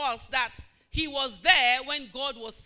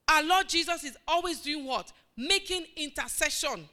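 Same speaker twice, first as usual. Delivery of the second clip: ordinary delivery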